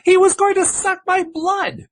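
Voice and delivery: slow, high, loud vampire wail. Retold or imitated. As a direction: ham up the vampire's plea. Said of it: "He even told you he'd be hurt".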